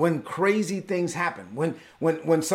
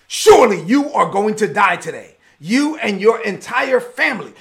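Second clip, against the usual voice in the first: loud, raised death sentence; angry king; 4 semitones higher, 7 dB louder than usual. Acted. Angry king condemning the priest and his family, loud acted fury. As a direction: pronounce doom as an enraged ruler. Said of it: "Saul was too angry to think rationally".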